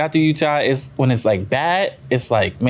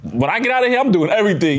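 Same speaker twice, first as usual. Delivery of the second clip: excited; 7 semitones higher than usual. Retold or imitated. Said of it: "He was like".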